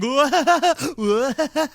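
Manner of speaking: high, loud mock cackle; imitated clown laughter. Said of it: "like making this, like, laughter".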